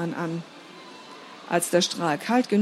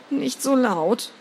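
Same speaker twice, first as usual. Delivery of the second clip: slow, drawn-out plea; pained slow plea; pleading; 4 semitones higher, slower than usual. Acted. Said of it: "flehte ich ihn an".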